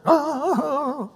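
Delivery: high, drawn-out mock "oh"; hammed-up groan of dismay. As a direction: act out an exaggerated, high-pitched dismay. God mock-reacting to a sinner.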